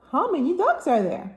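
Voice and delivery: plain reading voice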